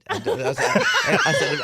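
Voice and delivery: usual speaking voice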